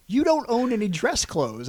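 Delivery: mock teasing friend. Friend teasing him in the van.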